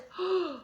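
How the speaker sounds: soft romantic intake of breath; acted gasp. Teacher as Romeo brushing Juliet's hand, soft acted gasp. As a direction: gasp lightly as hands touch.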